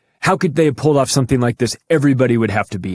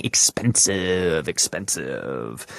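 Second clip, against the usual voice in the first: much lower and slow, dropping to almost nothing; mock deep echo; 7 semitones lower, 7 dB quieter, much slower than usual. Host mocks Kennedy calling the moon trip expensive, low and slow. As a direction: drag the word down like a fading echo.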